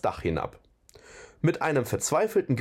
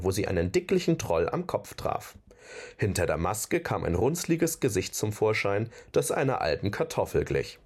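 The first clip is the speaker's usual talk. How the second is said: ordinary delivery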